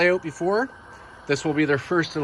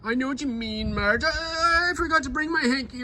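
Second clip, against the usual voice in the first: higher, softer, mock tearful old man; Henry; 8 semitones higher, 3 dB quieter than usual. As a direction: play a sniffly old gentleman. Henry sad about the track.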